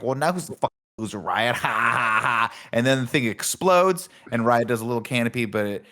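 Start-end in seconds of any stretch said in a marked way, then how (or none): 0.0-2.5 quick mocking taunt ending in a fake laugh; gloating villain. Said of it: "He's like".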